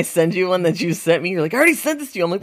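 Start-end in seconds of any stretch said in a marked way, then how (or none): 1.5-2.2 indignant complaint. Retold or imitated. Acts out friend's complaint.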